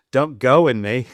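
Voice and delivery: loud alarmed cry from another room; loud yelled quote. Retold or imitated. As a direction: call it out in alarm. Girl yelling from back room.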